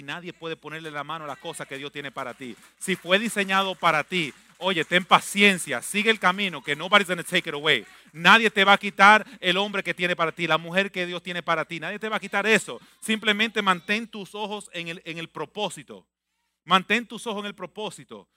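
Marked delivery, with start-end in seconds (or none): 2.8-16.1 loud fervent preaching. Acted. Preaching at full force. 16.6-18.3 louder, pressing exhortation. Acted.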